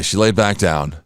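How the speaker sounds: normal talking voice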